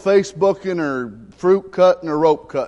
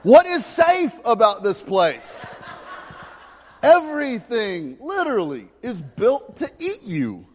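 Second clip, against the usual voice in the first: laughing exasperation; 7 semitones higher than usual. Real, not acted.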